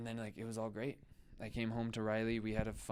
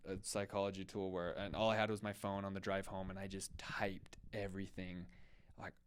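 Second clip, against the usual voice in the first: ordinary talk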